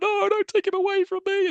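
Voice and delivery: high-pitched imitation of frantic begging; mock desperate plea. Fan retelling a boy being dragged away, mock desperate plea. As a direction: plead in an exaggerated high voice.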